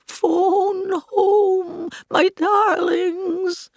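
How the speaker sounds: high, very slow alien croak; teasing E T. Retold or imitated. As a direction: tease the crowd as E T. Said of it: "she teased the crowd".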